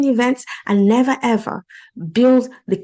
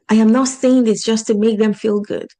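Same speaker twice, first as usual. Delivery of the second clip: ordinary talk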